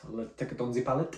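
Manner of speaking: a quick, light French accent on the words. Real, not acted. Whisky taster slips into a French accent for a moment. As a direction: slip into a French accent while tasting. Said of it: "Don't know why I had a French accent then".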